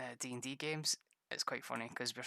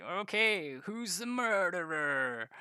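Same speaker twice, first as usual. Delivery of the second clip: naive player voice; 6 semitones higher, 4 dB louder, slower than usual. Retold or imitated. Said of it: "and you're like".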